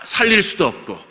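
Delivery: ordinary delivery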